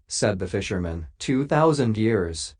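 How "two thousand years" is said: ordinary delivery, nothing marked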